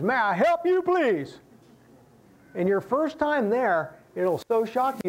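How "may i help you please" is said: high bright singsong "May I help you, please?"; greeter's sing-song welcome. Retold or imitated. Pastor imitating the Gates and Sons greeter, high singsong call. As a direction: chirp it like an eager restaurant counter clerk. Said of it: "you're gonna hear these words".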